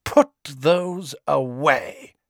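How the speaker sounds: slow, icy command; cold. Acted. Mother ordering the boys' wands down, cold. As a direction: order it coolly, each word drawn out. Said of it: "she said coldly".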